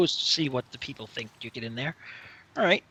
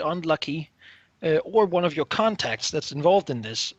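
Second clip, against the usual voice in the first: normal talking voice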